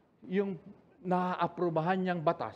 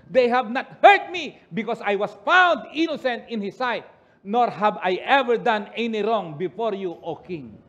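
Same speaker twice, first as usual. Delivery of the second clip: loud, slow, high proclaiming answer; Daniel's loud reply; 5 semitones higher, 9 dB louder, slower than usual. Acted. Daniel answering the king from the den, proclaiming.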